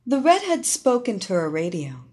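usual speaking voice

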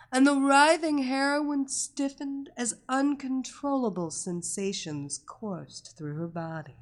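plain reading voice

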